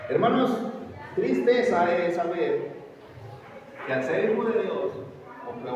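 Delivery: full-force sermon cry; fiery preaching. Acted. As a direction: preach it hard to the congregation.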